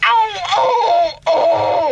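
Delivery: high ow breaking into a laugh; laughing pain. Acted. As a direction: ham up the pain half laughing. Rear view adjusted.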